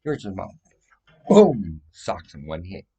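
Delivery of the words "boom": sudden loud "boom" for a punch; very loud exclamation; shouted impact. Acted. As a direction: punch the word out explosively. Acts out the blow landing.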